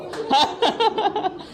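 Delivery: light high giggle; high laugh. Real, not acted. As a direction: break into a laugh. Laughing after impression. (ऊँची हँसी)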